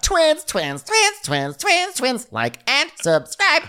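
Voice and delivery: very high, repeated squeals; mock excited squealing. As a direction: chirp it over and over in mimicked glee. Mimicking women thrilled at matching jackets.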